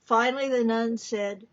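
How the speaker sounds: usual speaking voice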